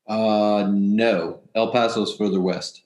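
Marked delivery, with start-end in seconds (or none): none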